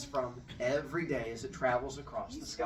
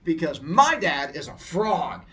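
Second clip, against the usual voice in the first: mocking bully; 7 dB louder than usual. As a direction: ridicule him. Bullies mocking the boy.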